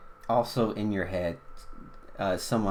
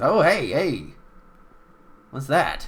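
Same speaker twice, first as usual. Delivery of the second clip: surprised shopkeeper; 6 semitones higher, 7 dB louder than usual. Acted. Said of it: "comes up and says".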